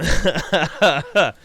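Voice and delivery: embarrassed laugh. Real, not acted. Laughing off his outburst.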